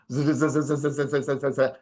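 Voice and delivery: buzzing run of repeated z sounds; absent-minded zuh-zuh-zuh while looking; searching filler noise. Real, not acted. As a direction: buzz through z-z-z while hunting for the next topic. Scanning notes for next topic.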